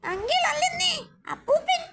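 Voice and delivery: high, fast, squeaky cartoon sidekick. Cartoon imp questioning the visitor, high and fast. Same character corrects the visitor.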